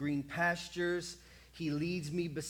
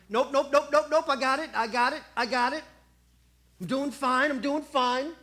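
loud, higher, hasty refusals; stubborn brushing off; 6 semitones higher, 7 dB louder than usual. Acted. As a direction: wave off help, insisting you're fine.

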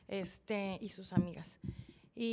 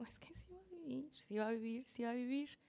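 retold desperate denial; 4 semitones higher, 3 dB quieter than usual. Wife refuses to accept her husband's death, pleading denial. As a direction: insist softly against the truth.

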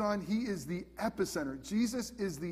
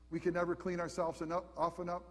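ordinary talk

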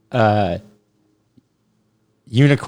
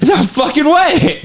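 mock disbelief; 13 semitones higher, slower than usual. Hamming up disbelief.

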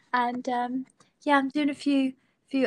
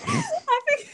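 giggling through the words; high bursts of laughter; laughing; 5 dB louder than usual. Real, not acted. Laughing at radio remark.

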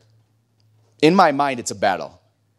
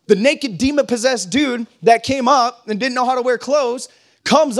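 higher, slow, loud preaching; preaching at full force; 8 semitones higher, 4 dB louder, slower than usual. Acted. Pastor driving home the point to his church, full-force preaching. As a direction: build the sermon to its peak, hammering each line.

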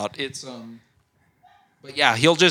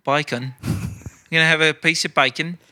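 mock British, "bacon" widened toward "bye-con"; British accent. Shown as a demonstration. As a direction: play a hammy Brit ordering food. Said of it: "say bacon in a British accent".

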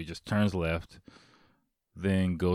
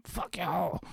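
retold yell; 11 semitones higher, 3 dB quieter than usual. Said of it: "yelling at the top of my lungs at them".

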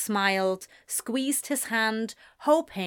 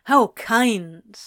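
louder sarcastic retort; mock gratitude, pushed louder; 2 semitones higher, 7 dB louder than usual. A narrator's reading. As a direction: throw it back with sarcasm. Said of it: "Anthony retorted sarcastically".

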